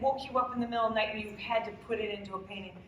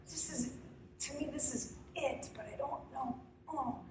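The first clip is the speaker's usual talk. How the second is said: soft, emotional admission; hushed and real, trailing into a small "oh"; 10 dB quieter than usual. Real, not acted. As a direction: speak quietly, moved by what you're saying. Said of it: "I'm being really emotional".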